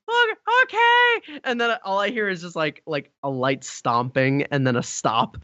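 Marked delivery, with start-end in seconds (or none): 0.1-1.4 put-on high, slow little-boy voice; Ryan. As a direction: imitate a child going along reluctantly. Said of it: "he was just like".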